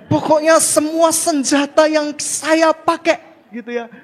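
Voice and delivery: loud, high fervent preaching; preaching at full force. Acted. Preacher shouting excitedly to the congregation. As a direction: rouse the congregation with every line.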